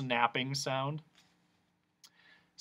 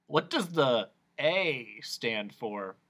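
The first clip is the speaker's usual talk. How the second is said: ordinary delivery, nothing marked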